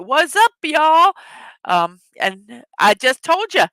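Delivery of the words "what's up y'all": loud casual greeting. Retold or imitated. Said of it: "He's like".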